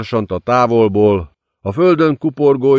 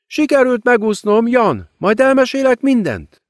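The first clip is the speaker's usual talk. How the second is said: high, silky demon queen mocking her victim; gloating demoness. A narrator's reading. Demon queen gloating over the ruined girl, silky and high. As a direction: savour every cruel word, sweetly. Lilith in Tess's role.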